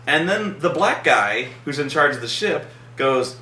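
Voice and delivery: normal talking voice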